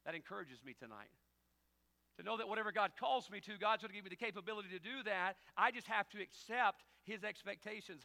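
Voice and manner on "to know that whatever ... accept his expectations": loud, high preaching voice; fiery sermon delivery; full-force preaching. Acted. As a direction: preach it hard to the crowd.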